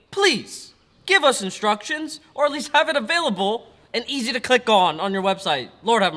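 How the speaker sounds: loud, exasperated begging; exasperated plea. Real, not acted. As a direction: plead loudly in frustration. Exasperated plea to tuning companies.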